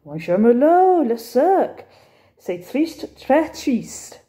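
a sad Frenchman sighing out the news; French accent. Acted. Cafe owner to Bertie, French-accented lament.

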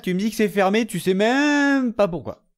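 fed-up rant at the game; exasperated complaint. Real, not acted. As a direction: drag out the frustration. Complaining door is shut.

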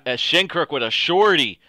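thrilled goal call at full voice; excited shout. Real, not acted. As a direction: yell the scorer's name like a play-by-play call. Calls the goal live.

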